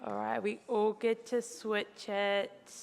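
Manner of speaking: usual speaking voice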